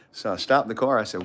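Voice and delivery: ordinary talk, nothing marked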